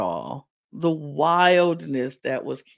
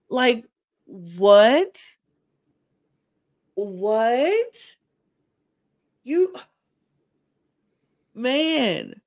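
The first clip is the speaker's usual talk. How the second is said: high-pitched disbelief, what?; stunned, can't believe it; rising incredulous what; 5 semitones higher, much slower than usual. Real, not acted. Disbelief at his confession.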